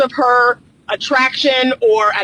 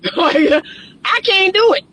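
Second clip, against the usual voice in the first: laughing; 7 semitones higher, slower than usual. Real, not acted. Laughing exasperation.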